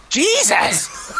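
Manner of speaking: high, exasperated outburst. Acted. Reader cut off yet again by cohosts, exasperated outburst. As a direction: throw up your hands at them. Interrupted again mid-email.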